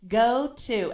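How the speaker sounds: exaggerated two-tone Go to; English inflection. Shown as a demonstration. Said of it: "It goes up and down".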